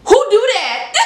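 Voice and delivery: loud, high disbelief; who does that, outraged disbelief; incredulous. Real, not acted. Incredulous reaction to possessed car.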